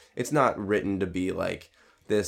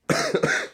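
sharp loud cough; coughing fit; 4 dB louder than usual. Real, not acted. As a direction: break into a cough. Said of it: "I'm sorry I'm coughing so much".

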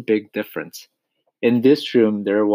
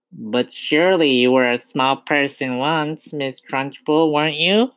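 put-on higher-pitched small boy's voice; little boy; 5 semitones higher, 3 dB louder than usual. A narrator's reading. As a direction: question the headmistress as a small pupil. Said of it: "brave little boy".

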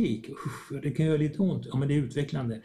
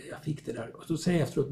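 normal talking voice

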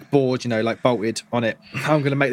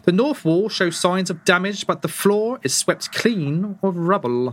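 scene narration; 5 semitones higher, slower than usual. Acted. GM describes the room.

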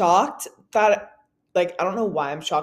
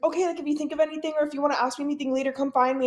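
high, quick imitated reassurance; 7 semitones higher, faster than usual. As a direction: play a kind star leaving the offer open. Voices [name] kindly reassuring a fan.

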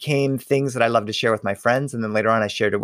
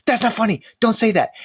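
upset protest; 9 semitones higher than usual. Retold or imitated. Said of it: "And now I'm like".

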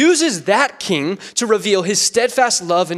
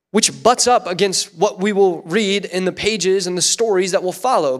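ordinary delivery

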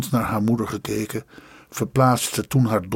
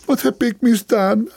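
high wailing plea, much higher; crying; 10 semitones higher than usual. Acted. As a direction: wail it at her son in despair. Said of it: "huilde ze".

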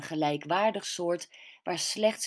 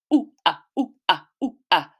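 ape protest; 2 semitones higher, 7 dB louder than usual. Acted. Anxious mother protesting her daughter's trip, loud monkey hoots. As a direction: hoot like a frightened ape. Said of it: "protesteerde mijn bange moeder aaphard".

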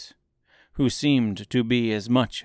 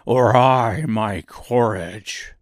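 loud, slow lion voice; lion character; 6 dB louder, slower than usual. A narrator's reading. Lion despairing of his courage, slow lion voice. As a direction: ask it heavily as a big slow lion.

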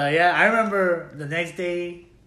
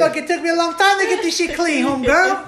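loud mock complaint; 13 semitones higher, 10 dB louder than usual.

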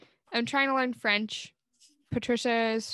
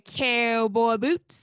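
slow Southern demo with long vowels; Southern twang; 4 dB louder, much slower than usual. Shown as a demonstration. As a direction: model a drawn-out Southern twang. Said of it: "prolonging, like, the vowels".

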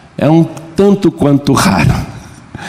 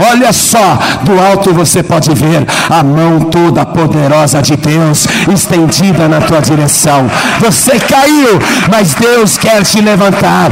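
fervent preaching; 6 semitones higher than usual. Acted. Pastor lifting up the sad believers with God's promise, fervent preaching. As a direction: lift the crowd with every line.

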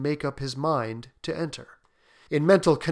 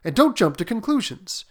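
anxious reassurance; 3 semitones higher, 7 dB louder than usual. A narrator's reading. Husband calming wife, pleading.

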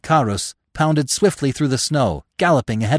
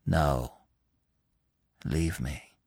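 low, soft, weary refusal; quiet; 7 semitones lower, 8 dB quieter, much slower than usual. A narrator's reading. Exhausted Gobo refuses Bambi's urging, quietly. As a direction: refuse quietly, with no strength left. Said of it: "Gobo answered quietly".

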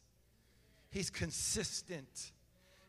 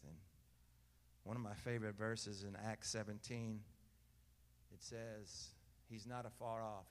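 hushed intimate preaching; 8 semitones lower, 10 dB quieter than usual. Acted. Pastor hushing the church to feel God near, softly. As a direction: draw them close with a hushed voice.

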